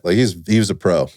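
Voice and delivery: ordinary talk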